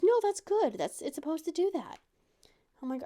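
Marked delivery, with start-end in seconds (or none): 0.0-2.0 high, loud, breezy doctor; doctor cheerfully reassuring the mother. Retold or imitated. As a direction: brush off the worry brightly. Doctor's cheerful reply retold.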